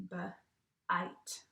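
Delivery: segmenting b-ite into sounds; sounded out. Shown as a demonstration. Teacher sounding out a word for her class. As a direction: pull b-ite apart, sound by sound. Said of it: "it says".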